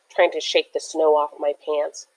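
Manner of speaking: ordinary delivery, nothing marked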